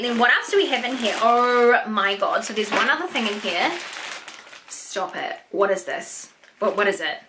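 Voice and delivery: normal talking voice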